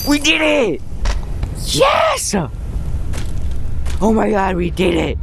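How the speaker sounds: high, breathless shouts of joy; yelling with disbelieving happiness; giddy relief. Real, not acted. Finally beat the level.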